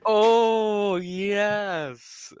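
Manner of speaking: loud, high drawn-out realization; imitated delighted locals. Friend mimicking locals finally understanding, hammed-up delight. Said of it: "'Cause then they're like".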